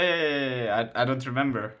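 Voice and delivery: usual speaking voice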